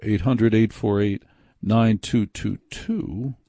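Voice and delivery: number read out slowly, digits spaced; slow repeat. Shown as a demonstration. Host repeating the call-in number for listeners, slowly. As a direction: let every digit sink in slowly. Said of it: "repeats phone number slower".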